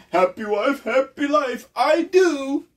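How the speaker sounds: high, far mock voice of a henpecked husband; mocking married men. Mocking the men he meets, high mock voice. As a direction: squeak it out like a whipped husband. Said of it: "who decided to say".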